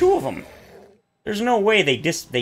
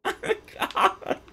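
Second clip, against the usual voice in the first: laughing in disbelief; 4 dB quieter than usual. Real, not acted.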